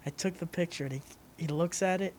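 ordinary talk, nothing marked

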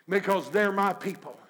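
ordinary delivery

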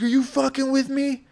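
a scared buddy squeaking out an accusation; panicked friend. Retold or imitated. Friend on the couch accusing him, high and panicked. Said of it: "He's like".

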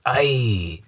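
ordinary talk, nothing marked

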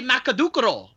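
"Makadukuro" broken into Japanese-style syllables; Japanese pronunciation. Shown as a demonstration. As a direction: say Makadukuro with a vowel after each consonant. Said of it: "In, in Japan, they say".

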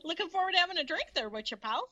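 high, fast mock Canadian accent; hammed-up Canadian accent, quick and high; fake Canadian accent. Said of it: "such good fake, uh, Canadian accents".

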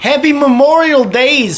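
high, slow, loud sing-song greeting; hammy announcer greeting. Acted. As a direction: ham it up like a TV host. Jokey holiday greeting.

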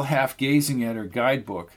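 usual speaking voice

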